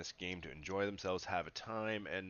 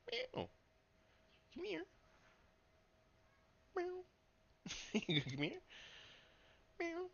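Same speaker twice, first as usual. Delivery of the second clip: cat talk. Real, not acted. Talking to the cat.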